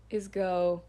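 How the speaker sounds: ordinary delivery, nothing marked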